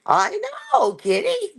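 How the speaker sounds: high pet talk to the cat, falling at the end. Real, not acted. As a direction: coo way up high at the kitty. Cooing to the cat.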